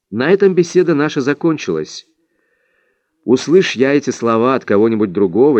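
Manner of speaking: ordinary delivery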